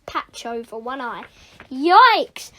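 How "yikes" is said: loud, high yelp; sudden startled exclamation; alarmed cry. Acted. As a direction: cry out on being caught. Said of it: "cried Bertie".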